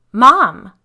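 loud, high scream for mom; terrified yell. Acted. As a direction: yell it in terror. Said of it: "she yelled, terrified".

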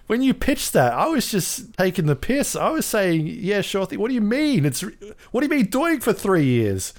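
shrill disbelief at three wasted years; exasperated rant. Retold or imitated. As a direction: boss losing it at his team. Exec ranting in retold scene.

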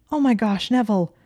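high, loud, alarmed cry; aghast outburst; shocked gasp. A narrator's reading. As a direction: exclaim in alarm, pitch jumping up. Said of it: "she gasped".

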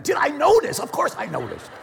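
high, loud mock-incredulous retort; hammed-up exasperated yell; incredulous outburst. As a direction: burst out high, then come down on the answer.

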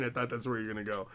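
ordinary talk, nothing marked

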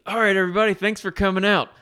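high, loud, overly cheery counselor; a peppy camp leader greeting the group. Retold or imitated. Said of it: "this is my impression of a counselor".

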